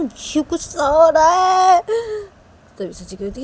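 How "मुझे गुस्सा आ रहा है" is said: loud, high, drawn-out cry of anger; angry outburst. Acted. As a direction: burst out slowly and loudly in rage. बेटा गुस्से में चीखता है. (गुस्से में खींचकर चीखना)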